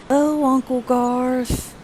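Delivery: slow, high sing-song; kids' chorus. A narrator's reading. Boys greeting their uncle in a lightly voiced sing-song. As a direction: drag it out like bored children. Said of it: "in a chorus of".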